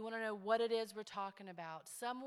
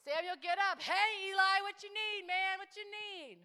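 put-on boy again; 9 semitones higher, 10 dB louder than usual. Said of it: "Samuel wakes Eli again".

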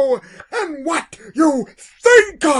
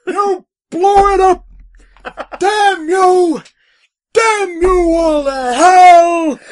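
loud, high anguished shout; Charlton Heston despair; 7 semitones higher, 9 dB louder than usual. Retold or imitated. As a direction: curse the ruins in despair. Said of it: "And finally".

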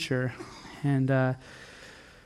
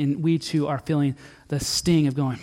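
ordinary talk, nothing marked

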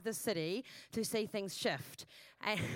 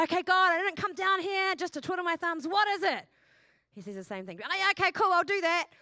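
higher, louder and upbeat; breezy mock prayer; 6 semitones higher, 5 dB louder than usual. Preacher acting her careless self talking to God, upbeat and loud. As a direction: brush it off cheerfully.

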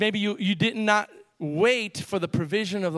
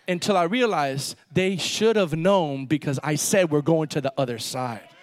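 ordinary talk

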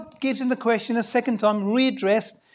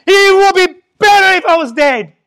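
furious shout, higher and much louder; angry shout; 4 semitones higher, 15 dB louder than usual. Acted. Jonah shouts at God that he'd rather die, furious. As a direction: yell it like a man who wants to die. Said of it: "He goes".

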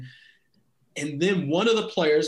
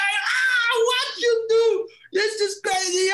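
exasperated coach; slower than usual. Retold or imitated. Coach Bora yelling at the sub still sitting down. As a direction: throw up your hands and yell at him. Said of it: "he's like".

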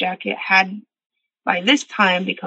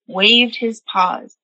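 usual speaking voice

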